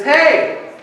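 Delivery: high, loud hail; acted shout from the shore; calling out. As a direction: hail someone across the water. Jesus calls out from the shore.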